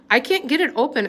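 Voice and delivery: put-on a frightened girl fumbling with her necklace, frantic. A narrator's reading. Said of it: "Ellen said frantically".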